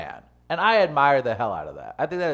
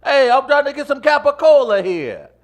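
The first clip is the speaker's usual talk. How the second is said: put-on loud, high New York accent with attitude; 12 semitones higher, 9 dB louder than usual. Showing off his New York accent, loud and high. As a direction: belt it like a New York deli regular. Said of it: "my New York accent and attitude".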